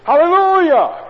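Hallelujah shouted high and loud, ringing; exultant hallelujah. Shown as a demonstration. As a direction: burst out Hallelujah like a lottery win. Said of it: "I have to say".

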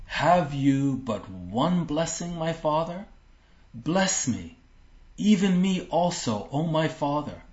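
high, pleading cry of a son; plaintive begging son. Acted. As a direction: beg your father, voice lifted. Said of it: "he's pleading".

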